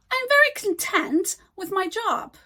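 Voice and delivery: "content" stressed on TENT, as the adjective; stress on second syllable. Shown as a demonstration. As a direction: say "content" as kun-TENT within the sentence. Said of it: "we stress the syllables differently".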